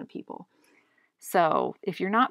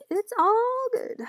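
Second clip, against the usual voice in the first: much higher and slow, stretched out; slow high sing-song; 7 dB louder, slower than usual. Pretend. Playfully reassuring herself while drawing, slow and high. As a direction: draw it out high like a silly song.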